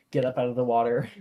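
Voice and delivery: ordinary delivery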